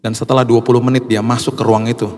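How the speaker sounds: usual speaking voice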